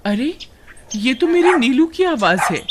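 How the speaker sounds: high, excited recognition; startled. Acted. Suman hearing her lost dog's voice, startled. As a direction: catch the sound with sudden surprise. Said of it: "वे दोनों चौंक जाते हैं".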